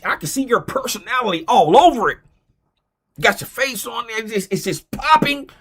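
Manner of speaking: mock excited colleague cheering him on; gushing other guy. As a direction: play the friend thrilled by the idea. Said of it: "He probably don't sound like that".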